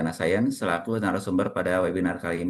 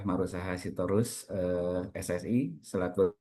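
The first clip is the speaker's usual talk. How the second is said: call dropping out; 7 dB quieter than usual. Real, not acted. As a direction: voice fading away on a bad line. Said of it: "Suaranya hilang".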